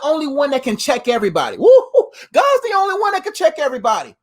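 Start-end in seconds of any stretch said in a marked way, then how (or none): 1.6-2.1 excited whoop. Real, not acted. Hype cheer. 2.3-4.2 higher, preacher-style emphatic restatement; pumped-up church proclamation; fired-up repeat. Shown as a demonstration. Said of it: "repeated for emphasis".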